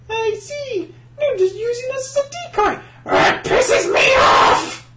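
high, huffy pig diva; squealing diva tantrum; Miss Piggy voice. Acted. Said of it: "get into Miss Piggy".

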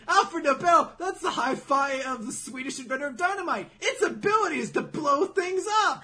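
high, loud dramatic exposition; exclaiming Mimi. Retold or imitated. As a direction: shout the reveal, building to the last words. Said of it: "fucking Mimi's like".

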